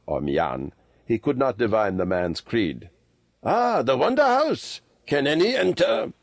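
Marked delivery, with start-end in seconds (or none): 3.4-6.1 put-on high-pitched old man in wonder; old lama, marvelling. A narrator's reading. Old lama asking Kim about entry, high and wondering.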